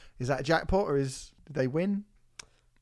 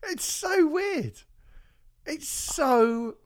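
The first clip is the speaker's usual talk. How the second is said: 1 drawn-out disbelief; 8 semitones higher, much slower than usual. Real, not acted. Marvelling at the strange video.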